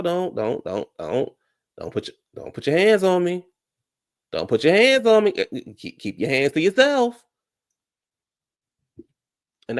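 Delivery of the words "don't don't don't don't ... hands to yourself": scolding. Acted. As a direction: warn them off sharply. Imitating slap-averse scolding.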